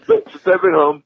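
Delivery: usual speaking voice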